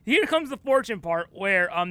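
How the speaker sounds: usual speaking voice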